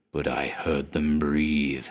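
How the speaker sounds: slow, breathy dread whisper; hushed whisper. A narrator's reading. Haunted man whispers of the creatures, slow and hushed. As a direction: hush it as if they might hear. Telling of horror.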